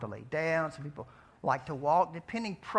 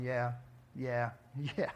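distracted husband; 3 semitones lower than usual. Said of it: "they're just going".

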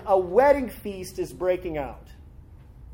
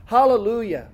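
Hallelujah called out loud and a little higher; proclaimed hallelujah; 4 semitones higher, 6 dB louder than usual. Acted. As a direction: lift it like a shout of praise. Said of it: "Once more they cried out".

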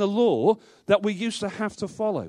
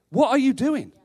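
higher and louder, frustration spilling over; exasperated outburst; 6 semitones higher, 6 dB louder than usual. Retold or imitated. Pastor voicing his frustration at straying believers, raised and louder. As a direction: let the frustration break out on the repeat. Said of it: "that I've wanted to say".

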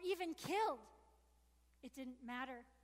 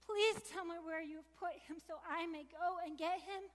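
higher, louder pleading cry; Mary pleading with the gardener, raised and desperate; exclaimed; 4 semitones higher, 3 dB louder than usual. Acted. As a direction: beg him urgently through tears. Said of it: "I exclaimed".

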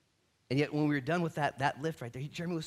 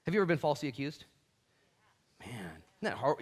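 ordinary talk, nothing marked

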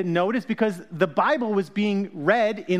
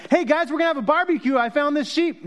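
fast, high party invite; mock-casual modern retelling of the shepherd; joking casual shepherd; 6 semitones higher than usual. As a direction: toss it off like a guy planning a barbecue. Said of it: "No, I'm just kidding".

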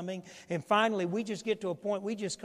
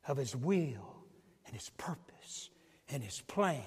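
hushed whisper; 4 semitones lower, 5 dB quieter, slower than usual. Acted. Pastor hushing the church at the climax, whisper. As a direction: whisper it, soft and breathless.

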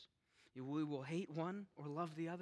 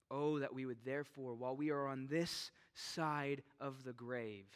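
ordinary talk

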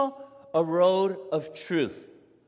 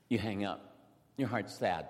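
sad; 9 semitones lower, 7 dB quieter than usual. Acted. Rejected applicant hangs up sadly.

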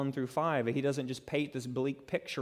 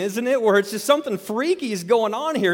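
normal talking voice

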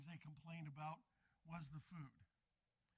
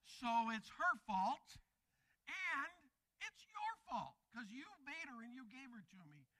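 mock accusation, jumping higher then dropping; blaming; 5 semitones higher, 4 dB louder than usual. Preacher playing Adam blaming Eve and God, accusing. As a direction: act out shifting the blame, pitched up.

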